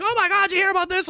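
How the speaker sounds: high, fast gossipy voice; gossipy friend. Retold or imitated. Friend quoted bringing up the news, high and fast. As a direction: squeal it like breathless gossip.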